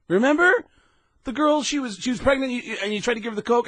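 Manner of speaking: high-pitched, incredulous prompting; insistent reminder. Acted. Kelly prompting blank Sam, acted.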